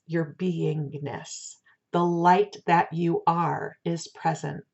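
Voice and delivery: normal talking voice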